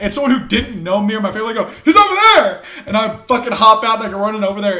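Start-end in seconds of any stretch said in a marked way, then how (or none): 1.8-2.6 very high, loud yell; retold call-out. Stranger pointing fighter to his dad, shouting. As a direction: imitate someone hollering directions across a room.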